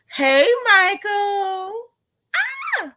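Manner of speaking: high, loud, drawn-out greeting rising into a squeal; real fangirl excitement. Real, not acted. Host greets Michael in the chat, thrilled squeal. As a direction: squeal it out, stretching the name.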